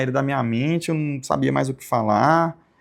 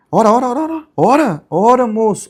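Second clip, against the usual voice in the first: loud, high imitation of an insistent prayer helper; insistent urging; 8 semitones higher, 8 dB louder than usual. Church brother pushing him to pray in tongues, insistent shout. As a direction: press him repeatedly, raised voice on each command.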